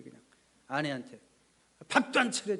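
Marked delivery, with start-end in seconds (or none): 1.9-2.6 loud, higher angry shout; angry yell. Retold or imitated. Husband yelling at wife about dinner, angry shout. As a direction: bark the complaint at her. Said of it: "소리를 질렀어요".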